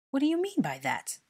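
high-pitched, loud indignant question; indignant demand. A narrator's reading. Dennis challenges Harry's rebuke, indignant. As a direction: demand an answer, affronted. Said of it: "Dennis demanded indignantly".

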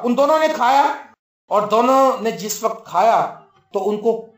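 ordinary talk

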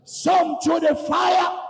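high, drawn-out preacher's shout; stretched, soaring revival cry. Acted. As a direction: cry it out slowly at the top of the range. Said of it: "somebody cry out and say".

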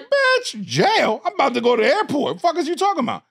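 high, loud, fast incredulous yell; outraged disbelief. Retold or imitated. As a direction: blurt it out, shocked at the word jail. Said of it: "I'm like".

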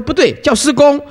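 a lightly voiced parent setting the child straight; correcting parent.

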